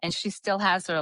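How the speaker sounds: ordinary talk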